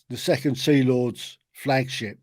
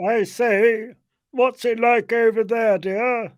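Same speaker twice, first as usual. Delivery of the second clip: high, hammed-up English character voice; put-on comic character; 9 semitones higher than usual. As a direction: call across in a silly high voice. Comic British character exchange.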